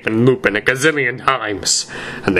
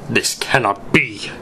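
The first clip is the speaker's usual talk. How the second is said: ordinary delivery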